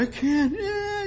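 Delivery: very high, small mock cry; mock whining. Preacher mimicking someone who cries and gets bitter, high mock wail. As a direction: imitate a crybaby giving up.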